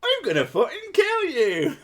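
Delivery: high, loud hammed-up Cornish threat; mock-menace in broad Cornish; shrieky comic Cornish accent, half laughing. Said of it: "The Cornish accent".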